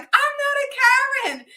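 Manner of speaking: very high mock squeal of denial; indignant call-out. Mom messing with girl from car window, indignant. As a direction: call it out high in playful outrage.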